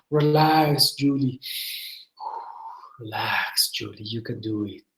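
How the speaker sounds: soft, low reassurance; calm the student down gently, voice dropping away; soothing. Real, not acted. Teacher tells Julie to relax, soothing and hushed.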